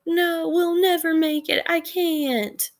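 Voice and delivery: mock the brother who gives up; hopeless Vern. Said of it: "But Vern's like".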